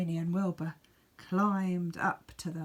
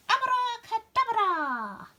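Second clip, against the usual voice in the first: very high, slow spell shout; high, drawn-out witch's incantation; 6 dB louder than usual. Acted. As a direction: cast it with a flourish.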